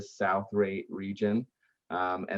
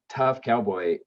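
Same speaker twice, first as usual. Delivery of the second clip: normal talking voice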